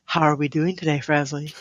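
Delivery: phone voice. Pretend. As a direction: sweet-talk like a call-center agent. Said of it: "I'm gonna use my phone voice".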